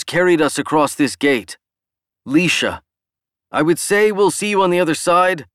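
loud, high rousing speech; rally the crowd, almost shouting; near shout. Acted. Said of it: "almost at the level of a shout once more".

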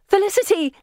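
high-pitched calling shout. A narrator's reading. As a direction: shout the name out urgently. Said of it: "I shout".

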